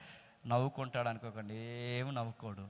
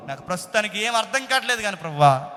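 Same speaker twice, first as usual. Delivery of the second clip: acted plea; 8 semitones higher, 9 dB louder than usual. Acted believer pleading with God.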